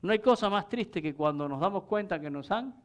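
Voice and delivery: ordinary talk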